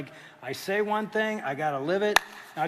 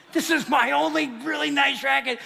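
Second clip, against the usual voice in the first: louder half-laughing protest; mock protest; 7 semitones higher, 5 dB louder than usual. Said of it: "And Craig's like".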